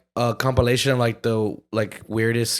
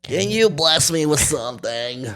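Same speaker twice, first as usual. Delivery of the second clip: slow, stretched begging voice; homeless man voice; 2 semitones higher than usual. Acted. Doing it again for friends, homeless man voice. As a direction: beg slowly in a put-on voice. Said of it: "I like your homeless voice".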